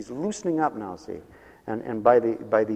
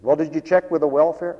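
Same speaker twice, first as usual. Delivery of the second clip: higher, louder quoted question; mimicked stingy relative; 5 semitones higher, 6 dB louder than usual. Preacher mimics relative dodging funeral costs, louder higher voice. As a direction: imitate a penny-pincher asking, pitched up.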